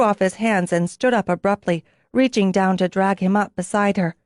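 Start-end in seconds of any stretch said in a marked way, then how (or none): none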